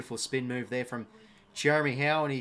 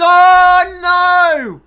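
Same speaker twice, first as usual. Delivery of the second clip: a huge real cry of dismay, much higher and louder; shocked shout; 14 semitones higher, 20 dB louder than usual. Real, not acted. Fan watching the match live yells in shock at the miss. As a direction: yell it out at the screen in disbelief.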